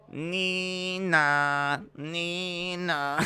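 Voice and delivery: sung nee-na siren wail; siren imitation. Impression of a French ambulance, siren nee-na. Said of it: "That is my impression of a French ambulance".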